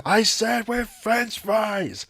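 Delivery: high, very slow muffled voice; comic muffled intercom; mock garbled intercom. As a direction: drag it out like a bad speaker.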